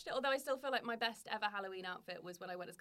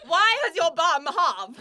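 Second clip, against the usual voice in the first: put-on German accent; 5 semitones higher, 15 dB louder, much slower than usual. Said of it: "Should I my German accent?".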